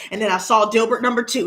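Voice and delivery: normal talking voice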